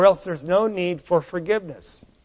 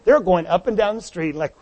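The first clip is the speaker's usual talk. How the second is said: ordinary talk, nothing marked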